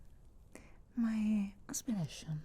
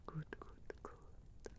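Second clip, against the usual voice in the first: much softer, whispered approval; trailing whisper; 15 dB quieter, slower than usual. Acted. Witch to the Warden she is healing, trailing whisper. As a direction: let the repeated word sink into a whisper. Coaxing patient to drink.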